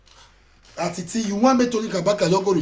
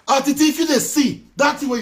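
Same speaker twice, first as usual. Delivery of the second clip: calling out; 9 semitones higher, 6 dB louder than usual. Real, not acted. Calling out Atiti.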